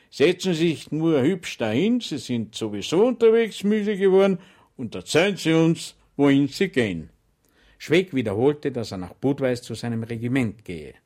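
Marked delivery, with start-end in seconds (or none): 0.1-7.1 much higher, louder sugary welcome; sergeant's mock welcome. Sergeant welcoming Schweig with exaggerated friendliness, higher and louder. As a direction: greet him with false warmth.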